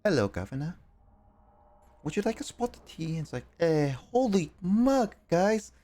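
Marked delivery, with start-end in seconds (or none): 0.0-3.2 high, soft mock British voice; hammed-up British "guv'nor" greeting; British accent. Imitating a woman's slight British accent, mock British. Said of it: "a little British accent". 3.6-5.7 high mock British exclamation; drawn-out, hammed-up British; British accent. Imitating her accent for the stream, mock British. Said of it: "a little British accent".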